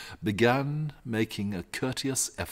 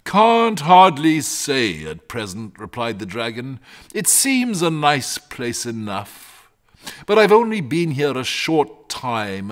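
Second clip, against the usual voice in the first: a cosy dragon musing aloud; 6 semitones higher, 7 dB louder than usual. Acted. Dragon chats with the boy.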